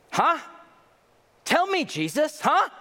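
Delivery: high mocking dare; taunting challenge. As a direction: taunt him, daring an answer.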